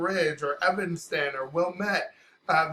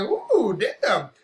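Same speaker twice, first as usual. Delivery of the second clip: impressed surprise; 7 semitones higher, 7 dB louder than usual. Retold or imitated. Amazed at seven-dollar meal.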